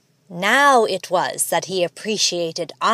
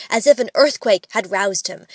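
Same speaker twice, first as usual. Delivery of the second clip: ordinary delivery, nothing marked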